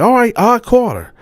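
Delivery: imitating a furious girlfriend; angry girlfriend. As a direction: confront him over the photos. Girlfriend takes up the challenge.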